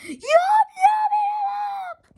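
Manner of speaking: very high, slow, loud monster chant; the toilets bellow their battle chant. A narrator's reading. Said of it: "The toilets bellowed".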